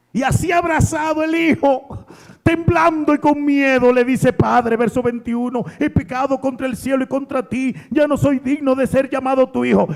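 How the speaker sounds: high, loud preacher's voice, rushing the quote; impassioned, hurried sermon delivery; fervent preaching. Acted. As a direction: preach the confession to the crowd. Preaching the son's confession.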